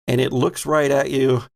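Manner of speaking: normal talking voice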